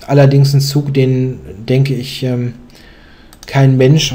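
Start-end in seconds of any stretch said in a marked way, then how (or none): 3.5-4.1 "Mensch" stressed, a little louder. Real, not acted. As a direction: lean firmly on the word "Mensch". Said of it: "die Betonung auf Mensch".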